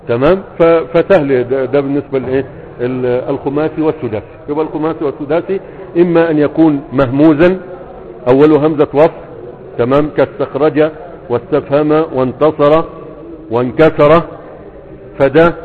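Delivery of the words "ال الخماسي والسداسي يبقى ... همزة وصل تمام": ordinary delivery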